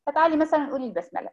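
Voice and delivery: ordinary delivery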